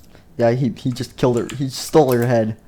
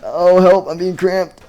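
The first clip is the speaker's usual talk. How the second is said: squashed cry for help; 6 semitones higher, 5 dB louder, slower than usual. Acted. Squashed in elevator.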